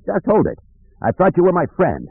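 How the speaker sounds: restrained shout to a friend; urgent shouted plea. A narrator's reading. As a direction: plead urgently, pitch thrown high. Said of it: "he shouted".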